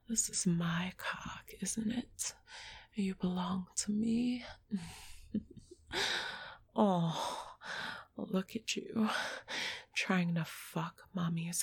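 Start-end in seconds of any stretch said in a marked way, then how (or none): 4.5-6.5 soft breathy laugh. Acted. Laughs at partner's eagerness.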